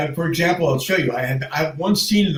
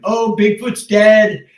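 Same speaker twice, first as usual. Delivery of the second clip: higher, slow mock news cry; mocking announcement; 6 semitones higher, 4 dB louder, slower than usual. Mocking the news about Bigfoot, slow mock gasp. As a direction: parrot the news with mock drama.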